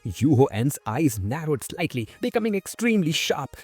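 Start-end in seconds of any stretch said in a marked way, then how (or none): none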